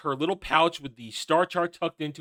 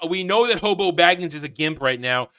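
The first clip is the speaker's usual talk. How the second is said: usual speaking voice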